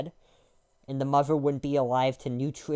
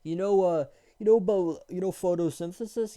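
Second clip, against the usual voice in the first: higher mock of a smug know-nothing; mocking dumb voice; 4 semitones higher than usual. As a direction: play the guy who just learned a big word. Mocking people asking about plants.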